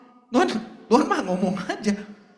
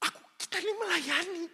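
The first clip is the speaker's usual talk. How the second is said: high, fussy complaining Martha; a put-out hostess whining about her sister; indignant Martha; 10 semitones higher, 4 dB louder than usual. Acted.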